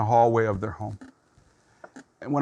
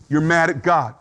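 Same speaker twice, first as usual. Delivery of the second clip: normal talking voice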